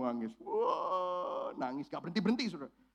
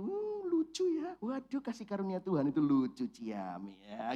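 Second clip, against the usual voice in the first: mocking baby-talk about cute grace. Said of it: "cute gitu".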